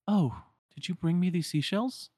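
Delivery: put-on high, girlish voice, gently surprised; a girl finding a gift by her pillow; curious Shion. Shion asking Roxas about the seashells, high and curious.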